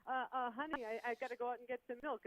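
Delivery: a husband sneaking out the door; sheepish husband. Retold or imitated. Brady making excuses to his wife, sheepish and hesitant. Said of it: "still you're like".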